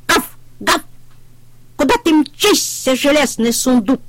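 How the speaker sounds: puppy yapping. A narrator's reading. Щенок тявкает на трактора, высоко и звонко. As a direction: bark it out like a cheeky puppy. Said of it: "протявкал щенок".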